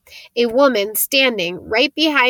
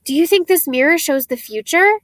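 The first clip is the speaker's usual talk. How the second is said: excited; 6 semitones higher, 3 dB louder than usual. A narrator's reading. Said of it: "to look excitedly at Harry".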